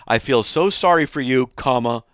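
preacher mockingly reading hate mail aloud. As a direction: read the insult back with scorn, comma and all. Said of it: "That's what he says".

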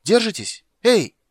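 high, loud frantic call; piercing urgent shout; shouting from above. A narrator's reading. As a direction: holler down encouragement. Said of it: "крикнул сверху Лютик".

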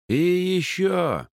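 calling out down an echoing hall; echoing call after her; hallway echo. Acted. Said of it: "Его голос раскатился эхом по коридору".

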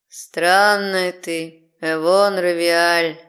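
slow sung demon voice; sing-song drawl. Acted. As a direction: croon the name, drawing it out. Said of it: "пропел страж, растягивая моё имя".

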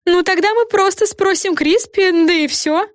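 high-pitched offhand fairy voice; high, casual character voice. A narrator's reading. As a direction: toss it out in a high little voice. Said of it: "бросила Френ".

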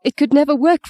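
high, panting, upset refusal; panted. A narrator's reading. Frightened woman telling him it could never work, panting. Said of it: "she panted".

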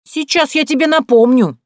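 high, loud menacing yell; threatening shout. A narrator's reading. Barbara grabbing the doorman, high loud threat. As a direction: threaten to make him remember.

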